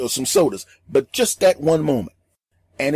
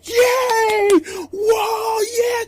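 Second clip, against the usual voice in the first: high, loud cheering whoops; crowd cheering; 3 dB louder, much slower than usual. Retold or imitated. The audience goes wild, loud high cheers.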